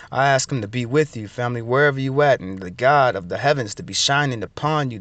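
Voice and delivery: low, measured prayer voice; spoken blessing. Real, not acted. Preacher blessing the family in Yeshua's name, low and steady. Speaks a blessing over listeners.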